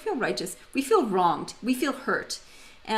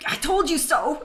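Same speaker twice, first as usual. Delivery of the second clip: higher-pitched mock-angry retort; frustrated outburst; 7 semitones higher, 3 dB louder than usual. Wife to husband after he ignored her advice, frustrated outburst. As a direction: throw it out in retold exasperation. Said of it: "I got frustrated".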